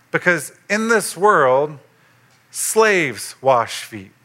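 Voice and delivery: ordinary talk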